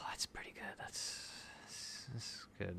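barely audible whisper; hushed reaction. Real, not acted. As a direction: murmur it to yourself, almost voiceless. Subdued reaction to a pull.

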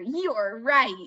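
ordinary delivery

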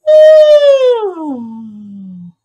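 loud woo gliding down from high; one loud woo falling down the range; sliding woo. Shown as a demonstration. Teacher models a loud descending woo for the class. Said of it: "Now I want you to go".

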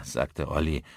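ordinary delivery

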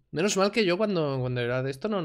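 ordinary talk

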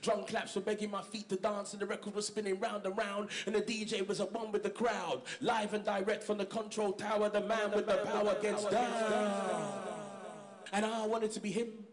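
rhythmic, steady performance-poet delivery; spoken word poem. Acted. Poet reciting his carnival poem to an audience, rhythmic spoken word. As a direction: perform it like a live poem to the crowd.

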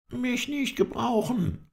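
very high, drawn-out indignant echo; indignant disbelief. A narrator's reading. Halef protests at being called no use, indignant. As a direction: repeat it slowly, pitched right up in protest. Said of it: "indem er ganz energisch".

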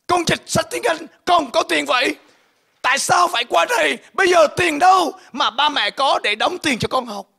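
high, loud tearful shouting at her father; a daughter's anguished, accusing wail; crying outburst. Retold or imitated. Daughter shouting at father over tuition, high and tearful. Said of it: "bắt đầu òa khóc lên".

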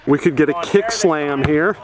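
ordinary talk

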